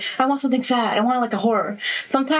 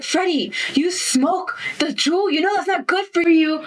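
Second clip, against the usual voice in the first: higher-pitched, incredulous telling-off; acted-out indignant lecture; mock scolding; 5 semitones higher than usual. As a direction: scold him like a disappointed friend, playfully. Acted scolding of dream character Freddie.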